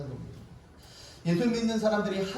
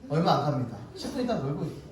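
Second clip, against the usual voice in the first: ordinary delivery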